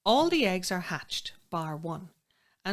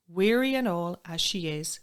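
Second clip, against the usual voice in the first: usual speaking voice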